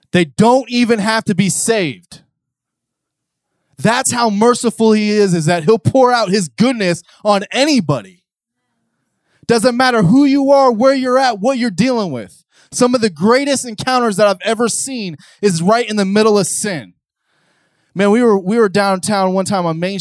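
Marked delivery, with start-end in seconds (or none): 0.1-2.2 "They don't even have to be saved" slow, high and loud; emphatic repeat. Shown as a demonstration. Preacher repeating the line louder to the congregation, emphatic. 3.8-16.9 high, loud preaching build; full-force preaching. Acted. Preacher telling the crowd the day chop ended, full force.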